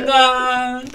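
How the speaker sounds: loud, high, drawn-out call; mock execution cry. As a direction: bellow the playful chop with relish. Playacts the king's beheading.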